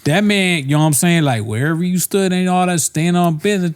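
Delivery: high-pitched, hammed-up slangy host; mock slang talk.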